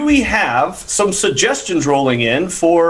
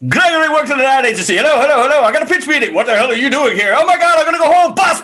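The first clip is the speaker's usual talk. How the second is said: fast, high and louder; rushed retelling; 9 semitones higher, 4 dB louder, faster than usual. Acted. Player cramming the story into five seconds, fast and high. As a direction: race through it in one breath. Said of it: "do exactly this in five seconds".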